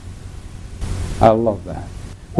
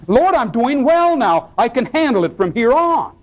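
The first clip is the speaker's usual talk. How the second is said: mildly smug and self-assured, higher; overconfident; 8 semitones higher than usual. Acted. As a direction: brush off help lightly. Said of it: "he thought he was walking".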